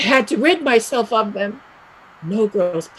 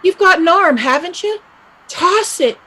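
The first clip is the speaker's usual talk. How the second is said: higher, pushier urge; firmer urging; 6 semitones higher, 3 dB louder than usual. Acted. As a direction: push her to do it. Urging Peg again, firmer.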